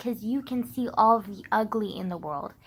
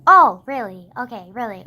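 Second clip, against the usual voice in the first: sarcastic; 12 dB louder than usual. Real, not acted. Sarcastic retort in rant.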